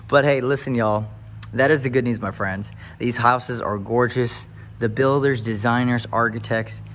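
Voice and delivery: rally the viewers like a pulpit; preacher hype. Acted. Husband hyping viewers toward the good news, preacher style.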